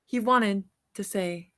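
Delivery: put-on bright, lively read-aloud for children; storybook reading. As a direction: read it like a bedtime picture book to kids. Reads picture book aloud.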